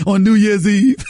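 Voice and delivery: usual speaking voice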